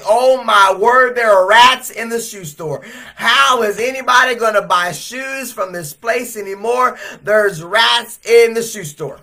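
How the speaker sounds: a loud, high wave of disbelief; amazed crowd. Retold or imitated. Crowd outside the store voicing their amazement. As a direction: marvel out loud.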